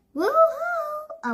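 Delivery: high call from afar. A narrator's reading. Aunt calling to the kids from her porch, high call. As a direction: sing out a high woo-hoo to get attention. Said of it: "a voice called".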